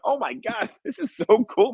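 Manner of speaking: very high, giddy mock squeal with a laugh; thrilled squeal. Director reacting to the crowd, in a very high giddy squeal. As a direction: relive the rush of the moment. Her own thrill at premiere.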